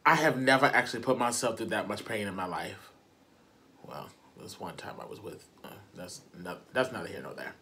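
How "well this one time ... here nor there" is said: mumbled aside. Real, not acted. Muttered aside.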